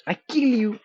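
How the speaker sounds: high, loud mock-menacing yell; mock threat yell. Streamer warning an intruder off the stream, mock threat yell. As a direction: shout it as a playful threat.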